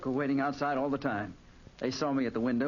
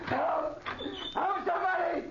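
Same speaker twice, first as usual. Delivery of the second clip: high desperate shout; shouting for help; slower than usual. Acted. Trapped man calling out the window for rescue, desperate shout.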